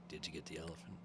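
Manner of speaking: low, very soft mock come-on; hushed insinuation. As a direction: murmur it low, as a suggestive secret. Imitates the attendant's suggestive question.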